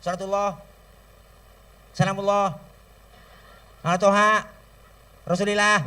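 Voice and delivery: shouted, tuneless blessing in separate bursts; just bark it out with no tune; loud sholawat without melody. Shown as a demonstration. Preacher showing sholawat without hadroh, loud and tuneless. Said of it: "Coba baca sholawat kenceng doang".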